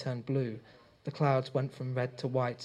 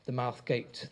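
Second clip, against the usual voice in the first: usual speaking voice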